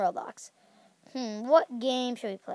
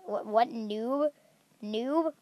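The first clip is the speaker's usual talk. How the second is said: usual speaking voice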